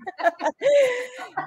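a high teary laugh; laughing through tears. Real, not acted. Mother embarrassed by her tears, teary laugh. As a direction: laugh it off while still crying. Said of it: "I'm crying".

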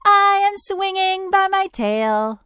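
very high-pitched monkey voice; bright-eyed monkey. A narrator's reading. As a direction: squeal it like a swinging monkey. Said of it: "said the bright-eyed monkey".

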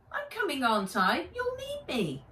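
put-on high childlike pout; pouting boy. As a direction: pout and insist. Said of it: "Henry pouted".

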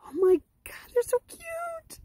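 high, slow gushing squeal; excited squeal. Real, not acted. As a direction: melt over how cute they are. Gushing over cute animals.

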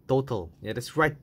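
ordinary talk

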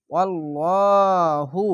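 মোটা করে ওয়াল্লাহু; heavy Quran recitation. Shown as a demonstration. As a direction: recite ওয়াল্লাহু thick and drawn out. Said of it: "এখানেও মোটা করে পড়লাম".

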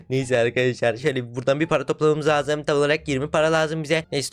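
normal talking voice

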